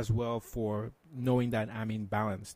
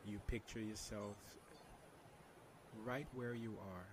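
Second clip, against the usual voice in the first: soft spa whisper; 16 dB quieter than usual. Shown as a demonstration. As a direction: guide them into calm, very softly. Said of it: "can you lead us through a meditation".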